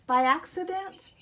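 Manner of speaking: plain reading voice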